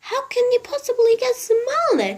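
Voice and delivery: a high-voiced lady asking in wonder. A narrator's reading. Mrs Silver asks Mr Hoppy how, in a high lady's voice. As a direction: lift into a high, fluttery lady's voice.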